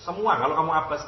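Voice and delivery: ordinary talk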